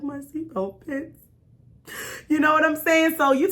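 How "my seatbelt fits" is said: near tears. Retold or imitated. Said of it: "I wanted to cry".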